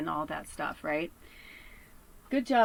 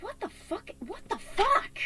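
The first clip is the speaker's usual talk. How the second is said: high-pitched, incredulous disbelief; exasperated, drawn out on the repeat; exasperated outburst; 13 semitones higher, slower than usual. Real, not acted. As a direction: let the voice leap up high in outrage. Upset outburst at bullies' remarks.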